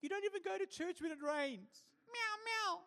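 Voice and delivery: high squeaky cat retorting; mock the lady as a sassy cat; kitty cat voice. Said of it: "what does a kitty cat say".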